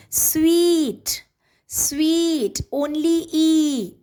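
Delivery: "sweet" with a long held ee, then "E" alone; drawn-out vowel demonstration. Shown as a demonstration. Teacher proving one syllable, stretching "sweet" and "E". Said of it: "this word has one syllable".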